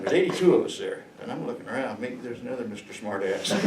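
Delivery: ordinary talk, nothing marked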